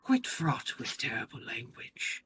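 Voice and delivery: put-on voice of an indulgent lord; young lord. Young lord explaining foul words to Aaron, put-on lordly voice. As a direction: speak as the composed young lord.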